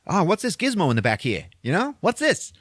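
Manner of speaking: lightly voiced puzzled teen; curious Vinny. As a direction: poke at the strange gadget, intrigued. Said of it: "So Vinny's like".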